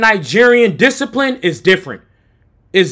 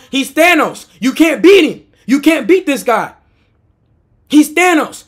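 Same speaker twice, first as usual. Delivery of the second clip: ordinary talk, nothing marked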